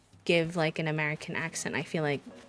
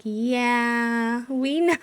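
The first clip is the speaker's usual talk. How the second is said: loud, high drawn-out knowing reply; mock a crowd stating the obvious, stretched out; exaggerated teasing "well, obviously" tone; 5 semitones higher, 6 dB louder, much slower than usual. Party crowd's knowing reply, acted.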